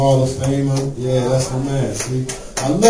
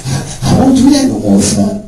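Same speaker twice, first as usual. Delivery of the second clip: higher, louder pleading; mock placating; 7 semitones higher, 6 dB louder than usual. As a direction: grovel in a put-on voice.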